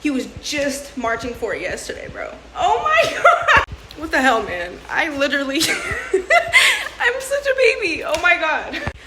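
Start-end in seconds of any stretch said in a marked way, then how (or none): 2.5-8.9 tearful laughter, voice jumping high; crying and laughing at once, embarrassed; laughing through tears. Real, not acted. Vlogger laughing through real tears at the caregiver clip. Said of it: "excuse the tears".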